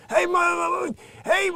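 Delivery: high, loud booming sitcom-husband call; Billy Gardell's big voice. Retold or imitated. Imitating the comic's big sitcom voice to the audience. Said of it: "I'm just waiting for the".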